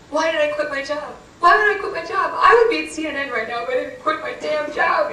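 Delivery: high, loud frantic rant; screaming at oneself. Retold or imitated. Driver alone in the car after hanging up, screaming at oneself. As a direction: build to a retold scream of regret. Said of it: "I'm screaming at myself in the car".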